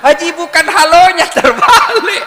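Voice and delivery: high, loud, breaking into laughter; loud laughing terbalik; exasperated laughing yell. Retold or imitated. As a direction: shout the correction while cracking up.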